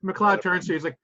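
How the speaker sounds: normal talking voice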